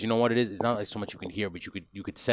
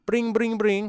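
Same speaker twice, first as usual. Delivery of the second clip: high, spoken ringing noise; imitated phone ring; 12 semitones higher, 4 dB louder, slower than usual. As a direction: trill out a ringing telephone.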